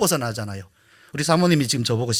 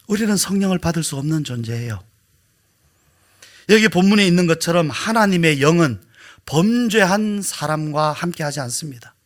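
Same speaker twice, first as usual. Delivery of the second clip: ordinary talk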